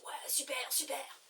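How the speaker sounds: imitation de la fille en chuchotant; chuchoté. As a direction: souffler les mots tout bas. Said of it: "elle me dit". (imitation chuchotée)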